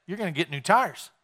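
ordinary talk

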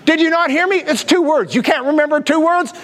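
high-pitched, exasperated scolding; exasperated yelling. Retold or imitated. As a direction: scold her in mock disbelief. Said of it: "He's just yelling at her".

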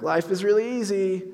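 sarcastic echo. Retold or imitated. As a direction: throw the words back wryly. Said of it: "Tyler's response to me sarcastically".

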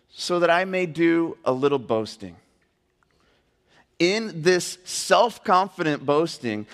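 slow, weighty reading aloud; measured preacher's reading with stressed key words; emphatic scripture reading. Acted. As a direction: read the verse deliberately, pausing between phrases. Reads scripture aloud to congregation.

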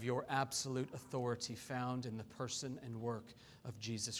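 low, soft public prayer. Real, not acted. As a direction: pray quietly and earnestly for the congregation. Leads congregation in prayer.

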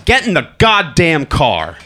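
slow loud order. Retold or imitated. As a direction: lay down the law slowly. Father ordering son to car.

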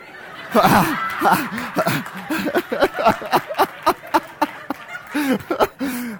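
big burst of laughter. Real, not acted. Laughs at phone call.

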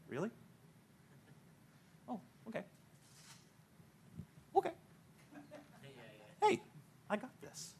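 soft, long pauses, talk himself into it; Gideon quietly convinced. Retold or imitated. Gideon overhearing the Midianites, hushed and reassured. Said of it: "I can see Gideon going".

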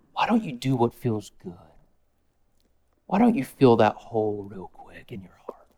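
low, soft seductive whisper; Satan whispering to a lonely heart; whispering tempter. Acted. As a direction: coax them quietly like the devil.

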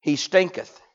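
a hint of archaic solemn phrasing; King James diction. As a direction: say it like old Bible English. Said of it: "She spoke King James".

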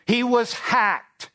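slow, high, disgusted drawl of a fed-up man; hacked off. Acted. The preacher sums up Naaman's mood in a slow, high disgusted voice. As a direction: drag it out like he can't believe it.

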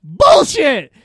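loud, high scoffing shout; retold shout. Uncle calling out nephews' fake website, loud and high. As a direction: call the kids' bluff loudly. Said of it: "And I go".

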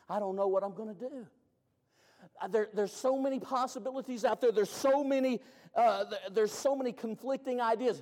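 high, fast, loud flustered voice; confused seeker. Acted. A confused seeker unsure what to believe, flustered. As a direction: gush like someone overwhelmed.

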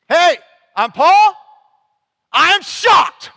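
high, slow, loud astonished Paul; shocked Paul. Retold or imitated. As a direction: act out Paul's shock, drawn out and big. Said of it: "That's what happens".

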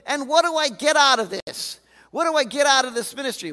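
loud, aggrieved protest at God; exasperated complaint to God. Retold or imitated. Preacher voicing Jeremiah, loud and exasperated. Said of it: "I'm like".

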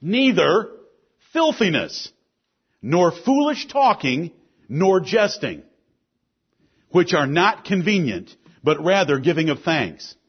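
emphatic, projected sermon delivery; forceful preaching. Real, not acted. Pastor warning the church about sin, forceful preaching. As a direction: preach it firmly, landing key words.

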